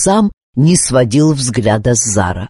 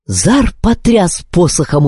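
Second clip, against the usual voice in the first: plain reading voice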